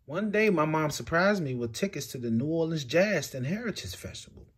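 ordinary delivery, nothing marked

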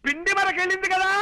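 high-pitched shouting for the hard of hearing; over-loud announcing. Acted. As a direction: bellow the news phrase by phrase. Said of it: "మామూలుగా చెప్పొచ్చు కదా". (గట్టిగా అరుస్తూ చెప్పడం)

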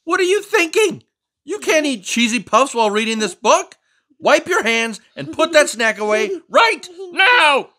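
high, loud indignant scolding voice; fussy book character. Acted. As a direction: scold the reader for snacking. Reads aloud as the fussy character.

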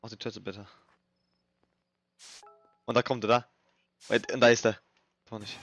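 ordinary talk, nothing marked

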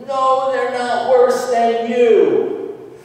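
high, loud, stretched-out rebuke; emphatic yell. Acted. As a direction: shout it down, drawing out the words. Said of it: "he yells at them".

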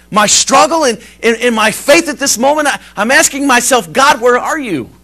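retold plea, high and loud; anguished outcry. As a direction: cry out questions to God. Retells woman crying out to God.

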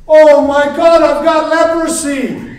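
high, loud hammed-up shriek; mock panic. Moses pulls out his hand, mock panicked shout. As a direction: scream it in comic horror.

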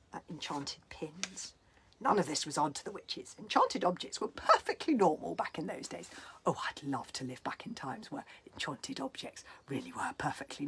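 dramatic storybook reading, whispered in parts; story narration. Acted. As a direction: read it like a spooky bedtime tale. Reads story extract aloud.

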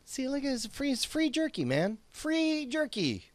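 high, hushed and eager; high excited whisper. Acted. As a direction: squeak it out, thrilled.

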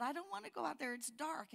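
put-on a small boy too scared to go outside; scared little boy. Boy afraid of the dark in story.

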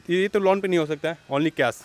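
ordinary talk, nothing marked

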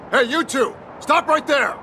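guard yelling. Acted. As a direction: bark an order at strangers outside the walls. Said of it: "yelling at us?".

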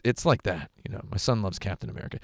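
ordinary delivery

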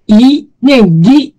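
"疑" said slowly and deliberately; slow model reading of 疑. Shown as a demonstration.

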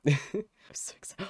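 whispered, voiceless excitement; excited whisper. Real, not acted. Fan after squealing at chat, excited whisper. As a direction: whisper it, too excited for full voice.

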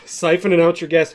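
usual speaking voice

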